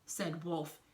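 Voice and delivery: usual speaking voice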